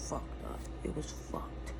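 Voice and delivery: soft low whisper, voice dropped. Real, not acted. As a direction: breathe it out under your breath. Admitting face damage, dropping voice.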